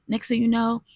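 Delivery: normal talking voice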